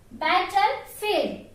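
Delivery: Battlefield said very slowly, syllable by syllable; slow model word. Shown as a demonstration. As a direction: drag Battlefield out for learners. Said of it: "How to pronounce that?".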